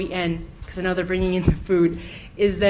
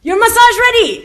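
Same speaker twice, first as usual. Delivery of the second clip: much higher and louder shouted call; screaming; 12 semitones higher, 9 dB louder than usual. Retold or imitated. Massage lady calling her client from the door, shouted high. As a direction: yell it across the lot to a client. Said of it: "the lady screams".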